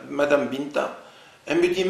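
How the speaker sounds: ordinary delivery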